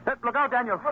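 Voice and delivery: sharp high call of alarm; warning shout. Acted. Father warns son, sharp alarmed shout.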